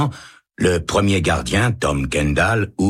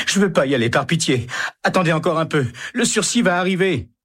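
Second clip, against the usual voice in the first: high, rising panicked begging; desperate tearful plea; 13 semitones higher than usual. Acted. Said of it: "se met à pleurer". (supplication aiguë et paniquée)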